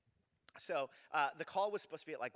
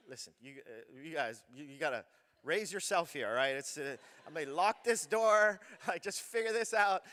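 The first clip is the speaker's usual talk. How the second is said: half-joking fed-up warning; exasperated to kids; 8 dB louder than usual. Retold or imitated. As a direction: tell the kids they're on their own, laughing. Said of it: "I was just like".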